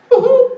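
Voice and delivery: high, loud delighted squeal of a wife; squealing woohoo. Retold or imitated. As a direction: let out a thrilled, high woohoo. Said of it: "like that".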